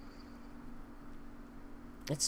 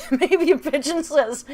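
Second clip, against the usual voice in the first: laughing; 10 semitones higher, 12 dB louder than usual. Real, not acted. Laughing at own joke.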